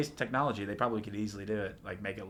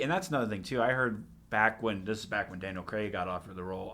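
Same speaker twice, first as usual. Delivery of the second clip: usual speaking voice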